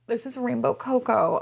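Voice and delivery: ordinary talk, nothing marked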